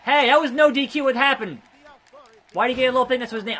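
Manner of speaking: high, loud, fast mock bewildered protest; hammed-up confused wrestler. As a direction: play a baffled wrestler protesting. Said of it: "that doesn't mean".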